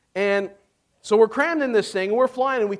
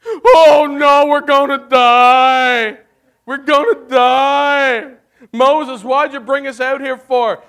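panicked wailing; 3 semitones higher, 7 dB louder, slower than usual. Retold or imitated. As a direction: wail like a crowd sure they are doomed. Israelites panicking, army approaching.

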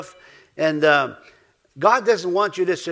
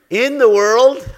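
loud and higher, announcing the verse; proclaimed; 5 semitones higher, 10 dB louder, slower than usual. Real, not acted. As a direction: call it out to the room. Preacher proclaims verse opening.

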